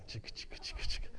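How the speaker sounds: unvoiced whispered chicka chugging; whispered train chug. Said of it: "the little engine that could".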